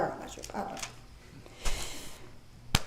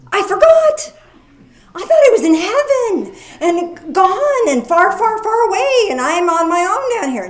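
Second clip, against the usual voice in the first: high, loud and laughing at himself; laughing mock dismay; 8 semitones higher, 6 dB louder than usual. Preacher mocking his own forgetfulness, laughing high and loud. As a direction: laugh through a mock lament about being left alone.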